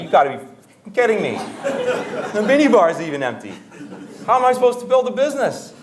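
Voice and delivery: high, loud, indignant tirade; mock outrage. Guest facing an empty minibar at night, comically outraged. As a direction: play up a whiny, exasperated rant. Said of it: "I was pissed off".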